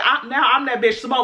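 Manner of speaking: ordinary delivery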